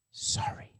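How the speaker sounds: a sly whispered aside, barely breathed; whispered sorry. Pretend. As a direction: whisper it like you don't mean it. Mock apology turned aside.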